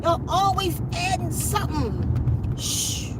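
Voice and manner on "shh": shushing. Real, not acted. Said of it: "hushing viewers".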